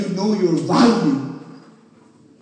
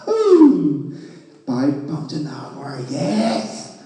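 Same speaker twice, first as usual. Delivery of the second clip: a drawn-out, slow mock "Ooh", then a lower, slow gloating "five pounds an hour, yes!"; mock delight; 6 semitones lower, much slower than usual. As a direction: play a worker thrilled at a pittance, sarcastically. Mocking a worker's small wage joy.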